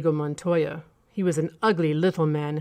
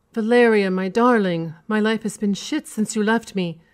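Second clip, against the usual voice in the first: sobbing plea; 4 semitones higher, 4 dB louder than usual. A narrator's reading. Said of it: "he began sobbing".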